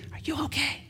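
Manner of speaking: quiet, gentle plea under the breath; soft repeat. Shown as a demonstration. Preacher gently asks the hurting man, softly. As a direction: ask again softly, almost to himself. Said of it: "you step in front of it and say".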